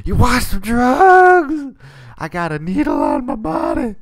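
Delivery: high, loud cartoon drug pusher; drug queen. Player mocking the drug queen for viewers, loud high-pitched caricature. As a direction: screech it out, stretching the words.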